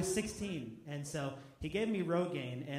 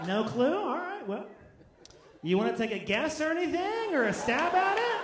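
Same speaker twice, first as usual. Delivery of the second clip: much higher, louder clueless cop; awkward trooper; 10 semitones higher, 8 dB louder than usual. Retold or imitated. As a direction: push it up high like a lost trooper hoping for help. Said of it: "I'd walk up and go".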